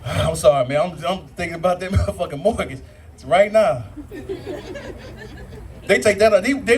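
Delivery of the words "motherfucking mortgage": usual speaking voice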